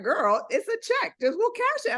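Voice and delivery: playing the teller who can't believe it; baffled teller. Teller to the panicked girl, baffled and amused. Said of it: "And she's like".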